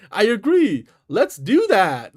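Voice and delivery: over-enunciate each word, excited; excited enunciation. Shown as a demonstration. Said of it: "with an, you know, excited ex-, enunciation".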